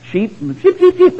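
high chirpy peeping sounds; mock bird chirp. Farmer trying out bird language, high playful chirping.

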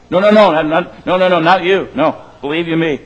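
emphatic protest. Real, not acted. Preacher teasing the congregation, a loud playful no. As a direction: wave it off hard, all in fun.

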